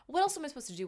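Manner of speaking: high, loud playful questioning; giddy curiosity. Retold or imitated. Patient in hallway waving at guarded man, high giddy curiosity.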